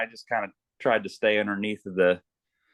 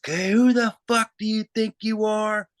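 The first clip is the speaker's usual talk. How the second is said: high, loud barking drill instructor; retold recruit-camp screaming, pitched high; drill instructor yelling; 13 semitones higher, 4 dB louder than usual. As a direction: bark it down the line like a boot camp instructor. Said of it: "I hear this guy yelling".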